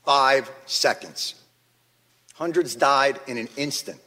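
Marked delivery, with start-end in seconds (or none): 0.0-1.3 slow heavy emphasis. Real, not acted. Repeating the key figure slowly.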